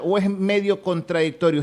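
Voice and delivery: ordinary talk, nothing marked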